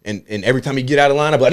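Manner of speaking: ordinary talk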